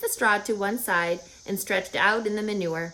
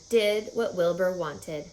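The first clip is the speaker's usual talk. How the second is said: ordinary delivery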